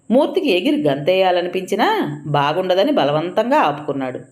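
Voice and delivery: usual speaking voice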